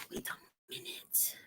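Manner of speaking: slow, breathy whisper to herself. Real, not acted. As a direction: whisper it slowly under your breath. Pressed wrong thing, mid-stream.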